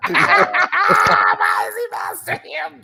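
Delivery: high, loud laughing disbelief; cracking up. Real, not acted. Cohost reacting to the nickname Master Hips, cracking up. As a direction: blurt it through helpless laughter.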